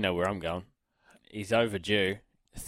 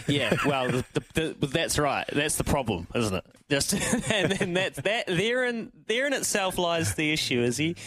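usual speaking voice

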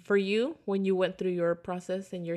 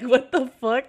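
high, loud laughing exclamation; 4 semitones higher, 8 dB louder, slower than usual. Retold or imitated. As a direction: crack up while quoting. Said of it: "I was like".

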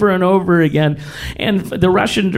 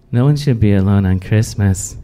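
put-on deadpan child line; 7 semitones lower than usual. Cindy Lou's deadpan movie line.

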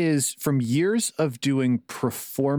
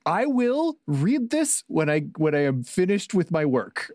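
slow, higher, deliberate self-restraint; forced restraint; 5 semitones higher than usual. Retold or imitated. Designer telling himself to finish his own book first, forced restraint. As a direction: draw it out, holding yourself back.

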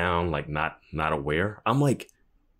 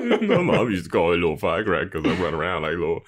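laughing; faster than usual. Real, not acted. Laughing while retelling.

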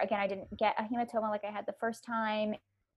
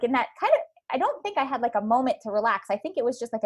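normal talking voice

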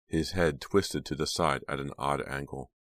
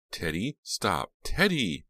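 high, slow pleading cry; desperate cries; 5 semitones higher, slower than usual. A narrator's reading. Jade begging Teddy to stop, desperate cry. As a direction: beg him to stop. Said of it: "Jade's desperate cries".

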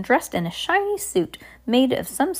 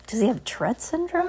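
ordinary delivery, nothing marked

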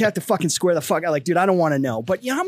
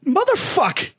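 high-pitched mock dismay; retold exasperation; 9 semitones higher, much slower than usual. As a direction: curse like you just walked in on trouble. Lieutenant catches kid, retold dismay.

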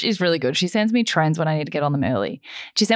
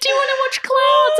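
a much higher, louder, sing-song mock invitation; imitating friend's invite; 4 dB louder than usual. Retelling how Ange invited her, in a high mock voice. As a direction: pitch the friend's question up high and bright.

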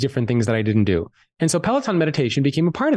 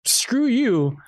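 higher-pitched, lightly acted retort; mock defiance; 9 semitones higher than usual. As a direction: play the body rebelling against its owner. His body rebels, voiced as a character.

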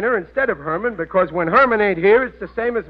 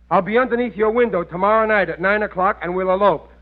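normal talking voice

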